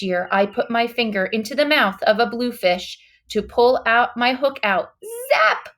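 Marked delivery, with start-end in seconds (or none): 5.3-5.7 high, punchy sound-word; sharp exclaim. A narrator's reading. Boy recalling the bluefish bite, high sharp exclaim. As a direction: snap it out like a bite.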